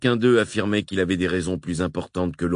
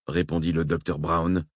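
normal talking voice

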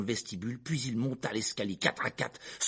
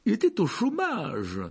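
strained higher voice, drawn out; nervous blurting uncle; 10 semitones higher, 4 dB louder, slower than usual. A narrator's reading. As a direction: blurt it out uneasily, pitch jumping. Tense uncle improvising a lie. (oncle mal à l'aise, voix haut perchée)

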